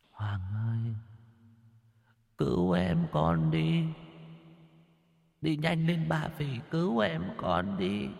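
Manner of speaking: high, soft, drawn-out ghostly grandmother; eerie high wail, hushed and slow; faint, broken ghostly whisper. A narrator's reading. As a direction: plead from far away in a dream, thin and halting. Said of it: "bà thì thào, đứt quãng".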